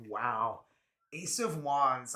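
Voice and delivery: ordinary delivery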